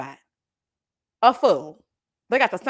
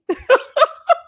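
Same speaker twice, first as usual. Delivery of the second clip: loud, high-pitched burst of laughter; real cackling laugh, high and loud; big laugh; 14 semitones higher, 7 dB louder than usual. Real, not acted. Streamer amused by a viewer's Taurus comment, laughing hard.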